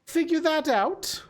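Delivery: put-on high, lilting old hag voice; hag character. Hag offers the party a deal for the treasure, wheedling hag voice. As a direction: play the bargaining hag, drawn out in places.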